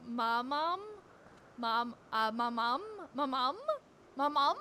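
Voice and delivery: Memnon said as "Ma mom", mumbled like calling mom; mispronounced name. Retold or imitated. Teasing a friend, mocking the garbled name "Ma mom". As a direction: say "Ma mom" slurred, rising like a question. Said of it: "always sounds like people are just saying".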